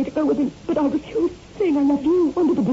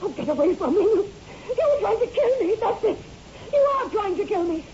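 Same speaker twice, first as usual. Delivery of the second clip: higher, frightened accusing cries; panicked accusation; 8 semitones higher, 3 dB quieter than usual. Acted. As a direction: back away from him in terror. Wife realizes husband wants her dead.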